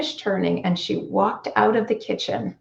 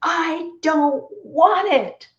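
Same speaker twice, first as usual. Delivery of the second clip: high, slow, drawn-out yell; retold yell; 10 semitones higher, much slower than usual. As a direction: snap back at the child, dragging the words out. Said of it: "and I yelled".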